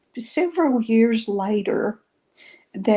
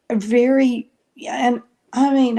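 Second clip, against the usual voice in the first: ordinary talk, nothing marked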